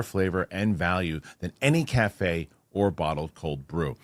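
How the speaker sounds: low, soft sponsor read; podcast host reading a coffee sponsor spot, low. Acted. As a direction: sell it warmly and easy.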